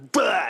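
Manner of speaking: drawn-out retching noise; imitated vomiting. Dad imitating his sick daughter, imitated vomiting. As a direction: spew out a mock puking sound.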